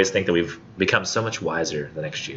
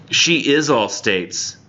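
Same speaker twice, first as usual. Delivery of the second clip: slow, weighty recital, stressing she; poem reading; 6 semitones higher, much slower than usual. Acted. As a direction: linger over every word of the line.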